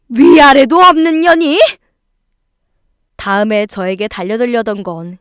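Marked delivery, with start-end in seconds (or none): 0.1-1.6 loud, high-pitched acted fury; outraged yell. In-law lashing out at the wife, outraged yell. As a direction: explode at the insolence.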